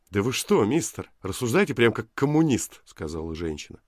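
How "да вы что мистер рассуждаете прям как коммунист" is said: indignant. Acted. Woman indignant at the stranger.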